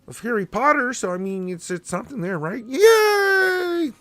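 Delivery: high Muppet frog voice, drawn out; a playful Kermit the Frog impression; Darth Kermit voice. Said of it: "My voice not ready for Darth Kermit".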